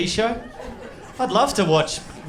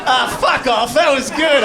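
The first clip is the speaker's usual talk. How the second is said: real annoyed outburst; 6 semitones higher, 4 dB louder than usual. Real, not acted. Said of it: "I don't like that reaction".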